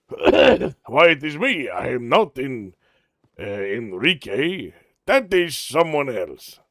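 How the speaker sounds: put-on Spanish-style accent with sing-song swoops; accent of someone doing an accent. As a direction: imitate someone faking an accent, hammed up. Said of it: "do an accent of someone doing an accent".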